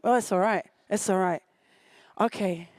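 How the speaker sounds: normal talking voice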